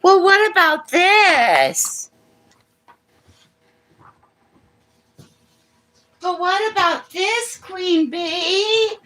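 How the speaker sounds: high, slow whiny mimicry; mocking high voice. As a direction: mimic her in a shrill squeal.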